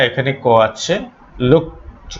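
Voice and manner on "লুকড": "লুকড" said as "lookt"; final -ed as T. Shown as a demonstration. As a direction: close "লুকড" on a voiceless t. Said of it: "ইডি উচ্চারণ হবে ট". ("লুকড"-এর শেষে ট উচ্চারণ)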